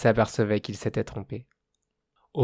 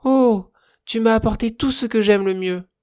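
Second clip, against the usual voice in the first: much higher, louder delighted cry; childlike joy; 9 semitones higher, 6 dB louder than usual. A narrator's reading. Fire spirit thrilled by the girl's gifts, childlike joy. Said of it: "d'une joie enfantine".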